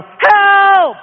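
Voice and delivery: long, high, full-volume shout; yelled help. Acted. Parched believer yelling to God, long high shout. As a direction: stretch out a loud cry for help. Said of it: "you yell".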